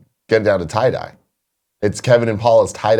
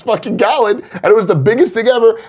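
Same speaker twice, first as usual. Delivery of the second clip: excited; 11 semitones higher than usual. Real, not acted. Recalling the huge gallon.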